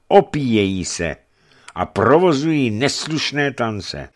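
high, emphatic denunciation; disapproving bishop. Acted. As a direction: list the pagan sins with indignation.

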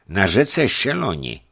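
high, emphatic denunciation; disapproving bishop. Acted. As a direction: list the pagan sins with indignation.

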